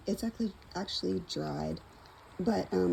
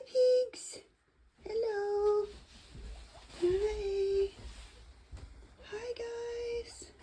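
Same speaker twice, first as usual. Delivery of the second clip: pet talk voice; 13 semitones higher, 6 dB louder, slower than usual. Real, not acted. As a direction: coo at the pets, way up high. Greeting her pet rabbits and pigs.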